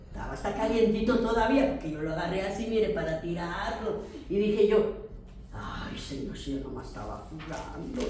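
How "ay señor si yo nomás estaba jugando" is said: hushed, breathless whisper of dismay; shocked whisper. Retold or imitated. Preacher retelling her plea to God after finding the rat, whispered. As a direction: whisper it as if caught out.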